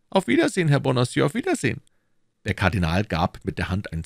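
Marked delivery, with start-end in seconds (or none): 0.1-1.8 higher, pressing questions as the Cardinal; Cardinal questioning. Acted. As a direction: interrogate his agent coolly. (der Kardinal, verhörend)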